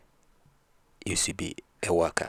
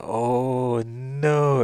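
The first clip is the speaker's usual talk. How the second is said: long drawn-out, higher and louder "oh no"; dismayed; 7 semitones higher, 8 dB louder than usual. Retold or imitated. Husband realises what she meant by beating, drawn-out dismay. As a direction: wail it in mock dismay.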